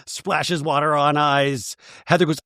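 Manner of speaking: ordinary talk, nothing marked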